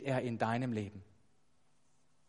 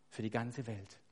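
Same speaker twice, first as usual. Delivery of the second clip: ordinary talk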